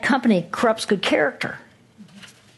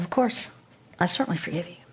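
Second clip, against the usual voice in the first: gentle forgiveness; 3 semitones lower, 7 dB quieter, faster than usual. Retold or imitated.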